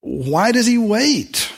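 high, drawn-out mock whine; plaintive questioning, acted out; imitated complaint. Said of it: "But people complain saying".

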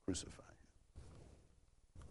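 whispered, drawn-out crucify him; hushed crowd cry. Retold or imitated. Preacher evoking the crowd at the praetorium, slow whisper. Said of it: "in the praetorium shouting".

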